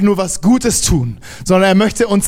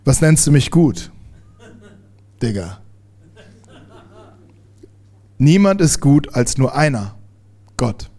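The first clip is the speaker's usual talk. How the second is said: mock bell-clear voice; 6 semitones lower than usual. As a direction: joke of a bell-like voice done low and calm. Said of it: "in seiner liebevollen, glockenhellen Stimme".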